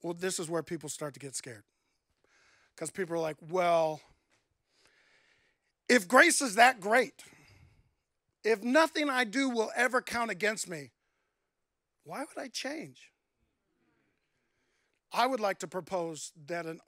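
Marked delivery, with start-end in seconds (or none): 3.4-13.1 a mock skeptic, louder and pitched up, questioning; doubting skeptic. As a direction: voice the doubter pushing back on grace. Skeptic voiced objecting to grace.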